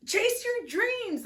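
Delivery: very high and slow, drawn-out proclamation; proclaimed title. Shown as a demonstration. Tarot reader proclaims the card title dramatically. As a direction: sing out Chase your dreams like an announcement.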